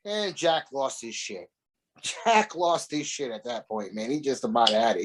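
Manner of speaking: usual speaking voice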